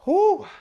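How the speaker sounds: loud high whoop of relief; triumphant whoo. Real, not acted. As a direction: let out a big whoop. Finishing the final set.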